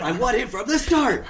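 high, loud playful demand; mock yell. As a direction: yell it out with a laugh in it. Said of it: "We, we all just yell".

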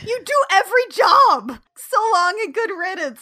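hammed-up goodbye to the boyfriend; mock diva dismissal. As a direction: wave him off like a spoiled star. Voicing Jem dumping Rio.

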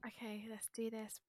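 breathy hushed whisper, fully unvoiced. Real, not acted. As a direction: whisper it like a secret pact. Whispered reply to are you ready.

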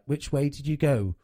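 loud, quizzing dad; a hint of a nosy dad. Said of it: "everybody's dad is always like".

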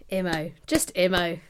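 fond mock blessing. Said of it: "I was gonna say".

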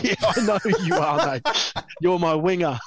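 Billy's reply laughed out high; laughing quote. Retold or imitated. Telling the camp story, laughing through the line. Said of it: "He goes".

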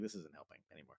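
very soft, low aside; quiet, private admission; inner thought. Acted. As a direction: think it aloud under your breath. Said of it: "at some point I was like".

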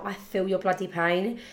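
ordinary talk

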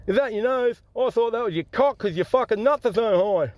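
high-pitched cleft-lip customer; cleft palate voice. Acted. Customer answering the big-nosed stallholder, cleft palate voice. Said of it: "This bloke got a cleft palate and a hair lip".

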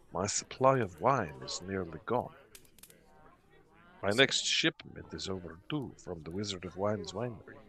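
hurt innkeeper, hushed confession; low voice. Acted. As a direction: confide it softly, a little wounded. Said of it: "in sort of a low voice".

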